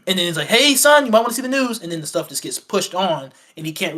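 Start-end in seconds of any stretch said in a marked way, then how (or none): 0.5-1.8 raised, higher voice calling across the house. Retold or imitated. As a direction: call him in from the next room, loud and bright. Mom calling Clark over.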